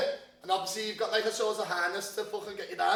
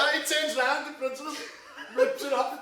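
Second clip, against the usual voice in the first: laughing; 6 semitones higher, 5 dB louder than usual. Real, not acted. Laughing while telling story.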